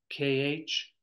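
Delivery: usual speaking voice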